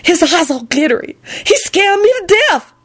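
high, scared woman; frightened Lucretia voice. A narrator's reading.

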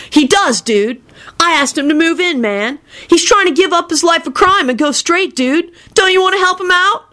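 high-pitched whiny dude; pleading. A narrator's reading. Kenny begging a home for his friend, pleading.

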